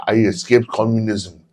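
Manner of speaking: hint of foreign emigre fervor; teacher on politics. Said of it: "He was like".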